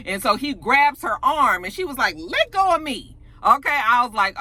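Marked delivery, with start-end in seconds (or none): 2.2-3.2 high-pitched mock protest; indignant protest. Retelling her reaction to her arm being grabbed, indignant. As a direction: pitch it up, demanding release.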